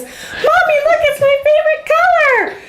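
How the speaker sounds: put-on high doll-like little girl voice; squeaky creepy child, like a talking doll. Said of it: "It was like a creepy little girl voice".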